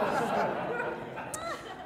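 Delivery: high unvoiced giggle; a real high laugh at the memory; high breathy laugh. Real, not acted. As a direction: let a squeaky laugh escape. Amused at the crying driver.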